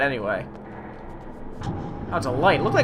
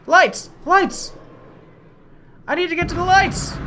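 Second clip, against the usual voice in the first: panicked shouting; 10 semitones higher than usual. Real, not acted. Panicked call for lights in dark.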